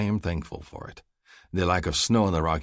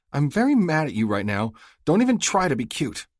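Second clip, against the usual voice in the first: higher, louder female voice, stern; angry Stacy; 9 semitones higher, 5 dB louder than usual. Acted. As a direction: tell him off through tears. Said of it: "swipes angrily at her cheeks".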